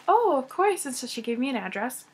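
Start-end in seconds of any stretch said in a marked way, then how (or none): 0.1-0.8 imitated reply. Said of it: "she's like".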